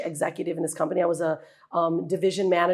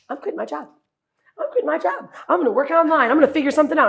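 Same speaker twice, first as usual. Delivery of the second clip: high and loud, bursting with resolve; excited announcement; 9 semitones higher, 7 dB louder, faster than usual. Retold or imitated. Wife bursts in telling husband she quits, excited and loud. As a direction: blurt it out, thrilled and sure. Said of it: "said to Brian".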